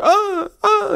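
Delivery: high-pitched mock moaning; loud sex moans, imitated in a high voice. Vlogger retelling what he overheard, high mock moans. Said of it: "you can hear the slap and hear".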